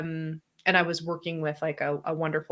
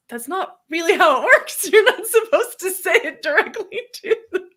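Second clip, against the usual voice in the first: high and loud, laughing through it; giggling correction; laughing while setting her straight; 12 semitones higher, 6 dB louder than usual. Retold or imitated. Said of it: "and I'm like".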